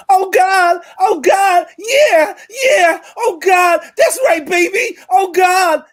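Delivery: loud, high fake American moaning; overdone American sex moans; American accent. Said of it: "in some mad American accent".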